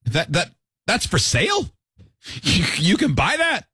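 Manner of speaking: stammering, slow incredulous questions; mock stunned disbelief; acted disbelief. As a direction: stumble over it, astonished, rising high. Acted disbelief of ordinary people.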